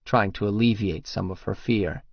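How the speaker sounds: usual speaking voice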